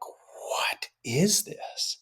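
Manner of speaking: slow, breathy whisper of wonder; awed whisper. Retold or imitated. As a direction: whisper it as if the world just opened. Inner wonder in a magical moment.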